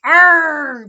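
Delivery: loud, high pirate roar; thundering arr. Acted. Big Pete roars back at Library Lou, loud and angry. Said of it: "Pete thundered".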